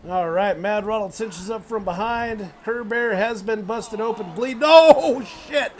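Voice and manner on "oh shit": loud, high "Oh, shit!"; stunned ringside yell; shocked outburst. Real, not acted. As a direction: blurt it out in disbelief. Reaction to big move.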